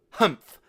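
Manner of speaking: loud scornful humph; contemptuous snort. A narrator's reading. Son snorting at his father's money. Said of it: "gave a contemptuous snort".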